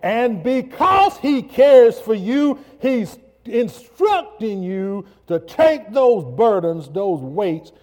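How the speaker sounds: rising into a shouted, high preaching peak; full-force preaching. Acted. Preacher to congregation, building to a shout.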